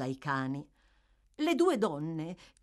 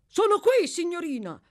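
high, loud eager answer; calling back; 12 semitones higher, 6 dB louder than usual. A narrator's reading. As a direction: call up to the mistress. Giles answers up the stairs.

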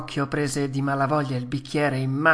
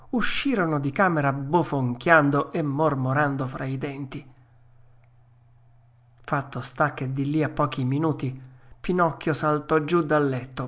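ordinary delivery

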